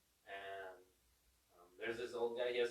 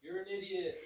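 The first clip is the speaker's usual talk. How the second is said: echo talking back; 8 semitones higher than usual. Retold or imitated. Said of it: "the Echo goes".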